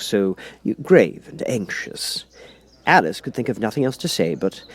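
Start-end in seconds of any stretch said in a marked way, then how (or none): none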